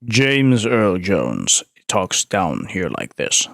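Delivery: very low, slow rumbling bass; James Earl Jones. Shown as a demonstration. Streamer attempting James Earl Jones for chat, very deep and slow. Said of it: "I will do my best to do James Earl Jones".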